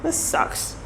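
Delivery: long breathy sigh then soft grumble; heavy sigh. Retold or imitated. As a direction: sigh it out, fed up. Groaning disappointment.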